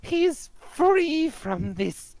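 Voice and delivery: put-on slow, high old-woman voice. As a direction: play an old woman, drawing words out slowly. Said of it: "the old woman kinda just takes her cart and says".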